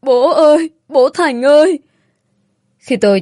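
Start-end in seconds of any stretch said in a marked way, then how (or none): 0.0-1.8 put-on high, loud child's wail for Dad; narrated child's desperate cry, pitched up; crying yell. A narrator's reading. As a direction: scream for your father through tears. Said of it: "vừa khóc vừa gào lên".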